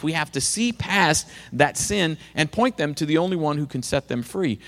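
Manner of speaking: ordinary delivery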